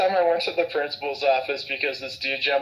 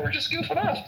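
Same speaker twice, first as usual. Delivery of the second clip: high protest; 12 semitones higher, 4 dB quieter than usual. Retold or imitated. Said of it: "I'm like".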